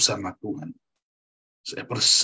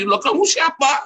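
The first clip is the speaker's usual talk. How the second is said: high-pitched startled shouting; retold yell; 4 dB louder than usual. Spouse challenging partner after fifteen years, retold high yell. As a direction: yell it like a bewildered, indignant spouse. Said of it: "Sampai anak teriak".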